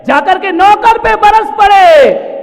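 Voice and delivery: high, full-force shout; thundering accusation. Real, not acted. Preacher blaming the listener for raging at servants, shouted. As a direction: bellow the blame, stretching the phrases.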